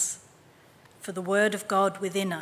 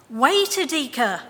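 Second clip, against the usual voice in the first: calling out; 5 semitones higher, 6 dB louder than usual. A narrator's reading. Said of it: "Mama called".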